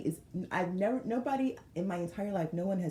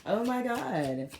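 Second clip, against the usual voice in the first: ordinary talk, nothing marked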